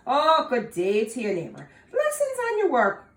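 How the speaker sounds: high, loud, laughing sweetness; butter him up with a grin; sweet flattery. Acted. Tom sweet-talking the leprechaun, high and laughing. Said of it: "while he smiled very sweetly".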